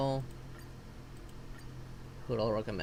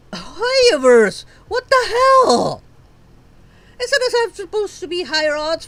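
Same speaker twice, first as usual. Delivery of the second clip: real yell; 9 semitones higher, 9 dB louder than usual. Real, not acted. Player shouting at Hoyoverse, in loud anger. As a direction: shout the question at the company. Yelling at the developer.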